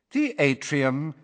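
slow, cool recorded announcement; a calm lift announcer voice; cool female voice. A narrator's reading. The lift announces the floor in a cool female voice. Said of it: "said the cool female voice".

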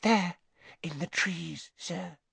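high, faint, halting elf voice; Winky. A narrator's reading. Frightened elf pointing out the place, timid hushed squeak. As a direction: trail off timidly. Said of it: "she whispered".

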